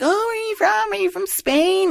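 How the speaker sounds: put-on very high falsetto girl voice; put-on high girly voice, asking eagerly; mock party girl. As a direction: imitate a curious girl in squeaky falsetto. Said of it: "this girl thought".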